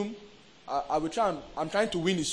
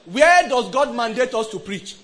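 slow, loud, raised-pitch question; loud preaching; 5 semitones higher, 10 dB louder than usual. Real, not acted. Preacher challenging people on where to preach, loud and slow. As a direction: ring the question out slowly to the congregation.

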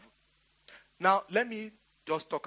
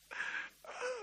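high breathy laugh; 10 dB quieter than usual. Real, not acted. Laughing at his own story.

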